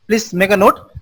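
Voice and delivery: usual speaking voice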